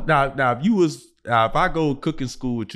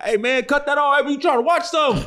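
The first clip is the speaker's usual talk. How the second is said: high, raised voice calling out; yelling complaint; 13 semitones higher than usual. Retold or imitated. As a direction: call out across the building. Yelling at neighbors over cable.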